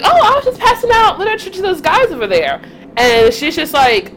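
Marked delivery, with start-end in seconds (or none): 0.0-2.6 high, breezy explanation; oblivious young self. Retold or imitated. Telling her partner about passing out literature, innocently high. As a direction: mimic a kid innocently explaining. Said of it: "I was like".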